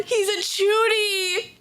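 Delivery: slow, drawn out lament; slow despairing cry. Real, not acted. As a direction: moan it slowly. Repeats it slower.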